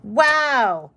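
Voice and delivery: loud, high, drawn-out wow; real burst of amazement; amazed. Real, not acted. Reader seeing the cards drawn, amazed.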